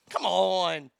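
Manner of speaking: usual speaking voice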